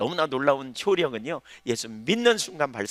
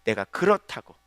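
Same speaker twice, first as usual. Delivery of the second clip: ordinary talk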